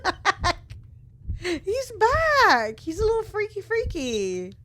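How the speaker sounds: high, loud laughing squeals; giddy high laughter at the ghost's return; laughing excitement. Real, not acted. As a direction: laugh through the words, delighted.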